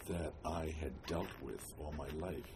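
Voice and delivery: ordinary delivery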